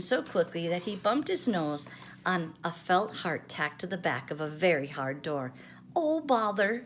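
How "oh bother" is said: sniffed. A narrator's reading. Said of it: "sniffed Pooh".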